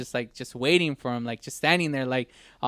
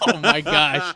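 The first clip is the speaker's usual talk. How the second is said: helpless, slow laughing exclamation; slower than usual. Real, not acted. Host reacting to a botched segment, laughing. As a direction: draw it out through real laughter. Host cracks up as show falters.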